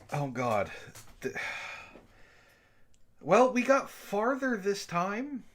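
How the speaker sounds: ordinary talk, nothing marked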